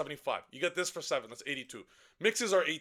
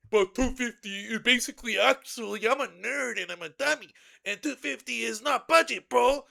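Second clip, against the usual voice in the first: lightly voiced whiny nerd, a bit louder; mocking a commenter; 4 semitones higher, 4 dB louder than usual. Parodying a complaining commenter, lightly voiced whiny mock. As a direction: sneer it like a dumb commenter. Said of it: "if anyone comments".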